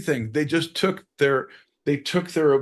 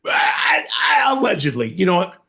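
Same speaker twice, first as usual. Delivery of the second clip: higher, louder flustered backpedal; mock panicked catch; 5 semitones higher, 4 dB louder than usual. Mock confessor backpedalling, flustered and loud. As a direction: blurt then catch yourself.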